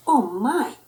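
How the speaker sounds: usual speaking voice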